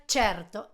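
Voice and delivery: "certo" as CHER-to; Italian pronunciation. Shown as a demonstration. Teacher showing "cierto" in Italian, "certo" with ch. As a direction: say "certo" slowly with ch. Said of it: "se pronuncia".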